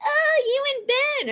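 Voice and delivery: very high, loud teasing squeal; cooing friends. Retold or imitated. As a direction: imitate excited friends gushing, shrieked up high. Voices gossiping friends.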